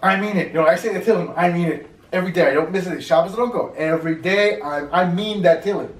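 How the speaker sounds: loud, emphatic retold protest; acted insistence. Man insisting on his daily Tehillim, acted insistence. Acting out man's earnest insistence.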